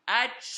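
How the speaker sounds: loud group-led sneeze; cheerful led sneeze for the kids; sneeze together. Acted. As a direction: sneeze big on the count of three. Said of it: "Do it with me. One, two, three".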